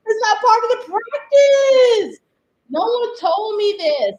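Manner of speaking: real exasperation; exasperated. Real, not acted. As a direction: drag it out in exasperation. Annoyed about the paint.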